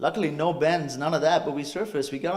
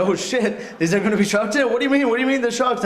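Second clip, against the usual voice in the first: higher-pitched panicked questioning; alarmed disbelief; 6 semitones higher than usual. Retold or imitated. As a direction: fire off questions in acted alarm. Acted alarm at learning of sharks.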